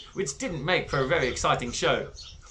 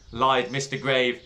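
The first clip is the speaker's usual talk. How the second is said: ordinary delivery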